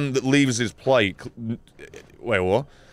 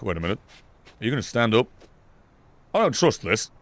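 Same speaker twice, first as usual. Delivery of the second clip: ordinary delivery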